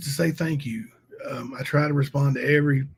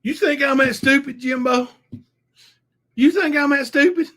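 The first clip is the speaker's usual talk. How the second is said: higher, louder whiny voice; mock whiny rival; 10 semitones higher, 6 dB louder than usual. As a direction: whine it like the coward. Mimicking rival's whiny refusal.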